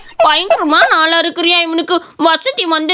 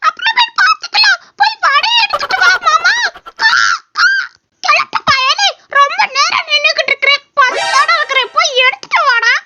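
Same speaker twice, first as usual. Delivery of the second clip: high, cartoon crow begging for vadai; cawing crow voice; 8 semitones higher than usual. A crow demanding vadai from the old man, high crow voice. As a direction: squawk it like a greedy crow, high-pitched. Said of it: "Kaaka பேசுதுன்னு".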